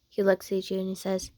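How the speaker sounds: plain reading voice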